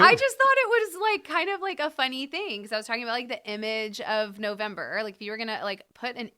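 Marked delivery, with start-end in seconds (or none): 0.0-2.6 squeaky, much higher protest; high-pitched protest. Real, not acted. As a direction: pitch jumps way up defensively. Defending her joke, high pitched.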